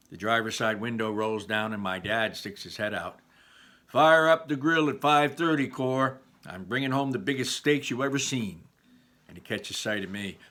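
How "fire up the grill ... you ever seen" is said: louder, higher hearty call; dad calling out. Acted. Dad shouting to his wife from the truck, loud and hearty. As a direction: boom it out the truck window.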